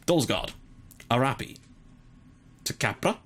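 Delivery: mock Italian accent on players' names; name each player in a playful Italian accent, with pauses between. Commentator idly calling the passes, Italian voice. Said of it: "doing it in an Italian voice".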